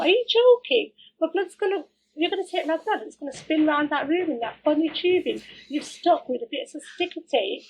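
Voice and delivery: high, loud, incredulous mockery; scornful disbelief. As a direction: scoff at the silly setup. Mocking the clinic's tubing.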